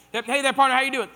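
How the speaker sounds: big, hammed-up Texas cowboy hello. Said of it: "We went with it".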